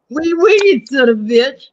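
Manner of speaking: very high, loud cartoon French accent; hammed-up French accent, shrill and loud. Shown as a demonstration. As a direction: squeal it in a mock French accent. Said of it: "That's my French accent".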